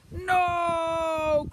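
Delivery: long, high, loud wail; crying out. A narrator's reading. Pair horrified by children, long loud cry. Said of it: "cried the pair".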